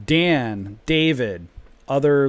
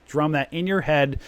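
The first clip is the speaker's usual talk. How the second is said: ordinary talk, nothing marked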